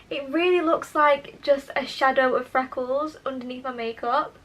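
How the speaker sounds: ordinary delivery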